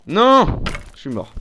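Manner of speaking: loud despairing no; panicking gamer. Real, not acted. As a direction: shout it as you die. Character dies in game.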